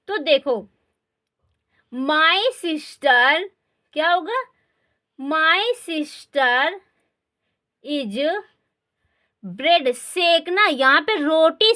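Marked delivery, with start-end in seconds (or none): 1.9-3.5 very slow, word by word; slow dictation. Shown as a demonstration. Teacher dictating slowly. 5.2-9.9 very slow, word by word; slow dictation. Shown as a demonstration. Teacher dictating slowly.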